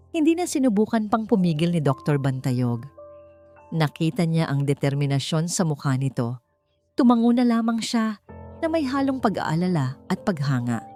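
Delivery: ordinary delivery, nothing marked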